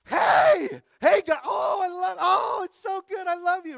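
very high, loud, overjoyed shouting; thrilled Jeff Goldblum. Retold or imitated. Pastor imitating Jeff Goldblum thrilled on the Jumbotron, high excited shout. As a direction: gush at the crowd like a starstruck fan. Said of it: "he's like, he's like".

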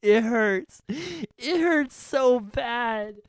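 high, drawn-out pained wail; loud, slow, hammed-up crying voice; mock whining. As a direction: whine like a hurt friend milking it. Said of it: "Stop whining".

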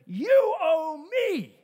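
slow, loud, high accusing "you owe me"; high, loud, slow grudge; voice of anger. Acted. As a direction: drag it out like a bitter accusation. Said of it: "Anger says".

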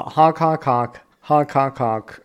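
goofy goose honking, honk honk; silly goose honks. Pretend. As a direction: honk like a German goose, hammed-up. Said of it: "I was honking in German at you".

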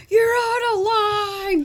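high, loud, slow police chief bark; gruff barking police captain. Retold or imitated. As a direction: play the furious chief scolding. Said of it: "stereotypical police chief boss kind of thing".

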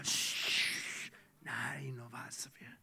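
hushed, sneaky whisper; secretive whisper. Acted. Thief hiding the silver in his tent, secretive whisper. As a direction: whisper like hiding stolen loot.

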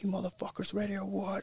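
high, hyped yell at the crowd; a pumped singer yelling at the crowd from the stage; fired-up crowd shout. Retold or imitated. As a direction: fire up the crowd as you dive in. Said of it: "like, fired up".

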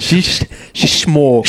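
put-on Irish accent. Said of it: "says that things shtaste".

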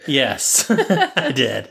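ordinary talk, nothing marked

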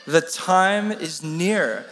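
slow, drawn-out recital of The time is near; slow unison recital. Shown as a demonstration. Preacher leading the congregation in a slow recital. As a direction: speak it slowly together with the room. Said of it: "Can we say it?".

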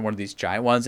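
ordinary delivery

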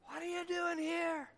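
God's question repeated softly to each listener; quiet question. Acted. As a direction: drop to an intimate near-whisper. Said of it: "God's question to you this morning is".

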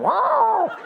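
very high, drawn-out mock yowl; comic high-pitched cat cry; imitated cat yowl. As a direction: wail like a startled cat.

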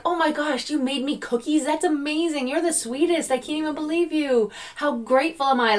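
high, delighted gushing over a small gift; gushing gratitude. Retold or imitated. Grateful friend thanking the cookie baker, high and gushing. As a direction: gush like someone handed warm cookies.

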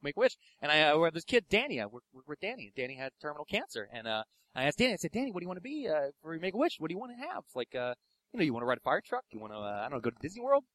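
soft, halting low mumble with fillers; soft, hesitant deadpan friend voice; McGrail's way of talking. Retold or imitated. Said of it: "that's how he talks".

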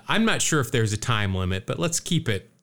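ordinary talk

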